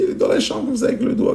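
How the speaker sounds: put-on high, breathy old granny voice; frightened granny pointing to the bedroom; old lady voice. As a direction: play the scared little granny.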